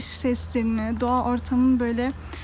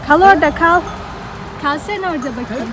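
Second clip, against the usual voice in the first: loud command to stay back; calling out; 3 semitones higher, 6 dB louder, faster than usual. Real, not acted. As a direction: shout at the animals to stay put. Telling the animals to stay back. (bağırarak seslenme)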